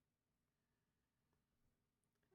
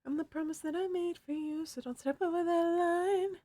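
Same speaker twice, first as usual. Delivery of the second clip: put-on character voice; 11 semitones higher than usual. Quoting game villain line in voice.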